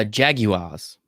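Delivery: Jaguars said slowly as "JAG-you-ahz"; British pronunciation. Shown as a demonstration. Host answering his co-host's question, British "JAG-you-ahz" for Jaguars. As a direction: say Jaguars the British way, three beats, no R. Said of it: "I think, as the British".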